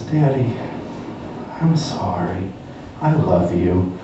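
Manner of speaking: put-on quiet, low little-girl apology; small sorry voice. Daughter apologizes sweetly to her angry father, quiet. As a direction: say it softly with big eyes.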